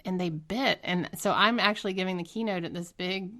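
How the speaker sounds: ordinary delivery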